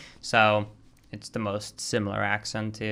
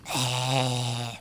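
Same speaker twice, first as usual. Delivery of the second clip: strained, held "aah" noise; imitated failing voice; 6 dB quieter than usual. Shown as a demonstration. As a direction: mimic your voice giving out on a note. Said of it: "without my voice going".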